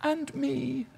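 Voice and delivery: put-on high, soft echo of a child's call; child's plea. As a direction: imitate a left-out child, softly and high. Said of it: "would cry out very loudly".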